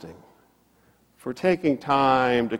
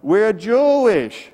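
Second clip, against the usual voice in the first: high, loud, stretched-out call; imitated crowd yelling a reply; retold yell back; 9 semitones higher, 6 dB louder, slower than usual. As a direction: holler it back across the water. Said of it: "they yell back".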